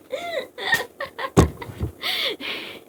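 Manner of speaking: real squealing laugh, very high; high-pitched laughter. Real, not acted. Reader cracking up at their own joke, high laughter. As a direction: crack up helplessly.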